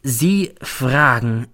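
"Sie fragen" drawn out very slowly, each word weighted; every word stressed. A narrator's reading. Colleague eyeing narrator oddly, "Sie fragen" slow and stressed word by word. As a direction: dwell on "Sie" and on "fragen" separately. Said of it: "als betonte er jedes Wort".

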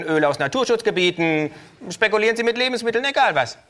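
high, loud, cheeky kangaroo voice; brash kangaroo. Acted.

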